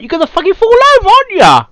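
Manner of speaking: loud, fast, high-pitched Cockney jeer; hammed-up Cockney taunting a first-time rider; mock Cockney. Explaining falling off a bike, in a loud mock Cockney accent. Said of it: "it's bloody Cockney".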